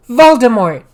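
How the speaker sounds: loud name with a falling finish; dramatic reveal. Acted. Revealing the villain, loud.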